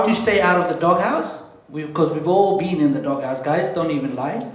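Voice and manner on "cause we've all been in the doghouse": usual speaking voice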